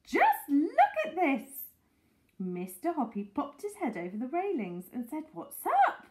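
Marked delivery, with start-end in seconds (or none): 0.0-1.6 high, loud and stretched out; excited shouting. A narrator's reading. Mrs Silver showing Mister Hoppy her discovery, shouting. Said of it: "she was shouting". 5.3-6.0 high, loud call. A narrator's reading. Mister Hoppy answering from over the railings, calling out. Hoppy calls down from his balcony.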